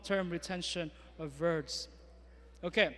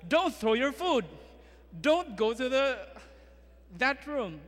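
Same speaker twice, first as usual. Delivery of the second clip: put-on higher, louder commands to a toddler; firm warning to child; 4 semitones higher, 4 dB louder than usual. Shown as a demonstration. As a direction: scold a child firmly. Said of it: "Like, for example".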